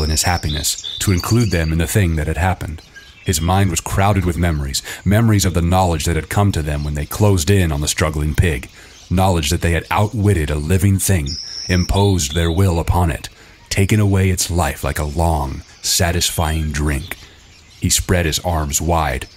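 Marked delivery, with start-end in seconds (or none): none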